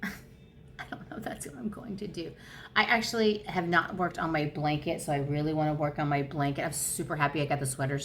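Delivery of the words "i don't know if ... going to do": very soft, higher, after a laugh; hushed, amused repeat. Real, not acted. Vlogger doubting her sleeve plan to herself, hushed and amused. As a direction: drop it to a small amused near-whisper.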